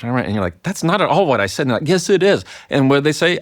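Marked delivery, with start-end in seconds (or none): 0.6-1.6 higher, indignant mock protest; indignant denial. Acted argument with spouse. 1.9-2.4 higher and louder insistent mock retort. Acted argument with spouse.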